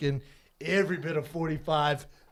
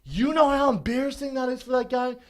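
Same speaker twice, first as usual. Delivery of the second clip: higher-pitched indignant scolding; mad dad; 7 semitones higher, 3 dB louder than usual. Retold or imitated. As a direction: imitate a father telling off his son. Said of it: "My dad's so mad".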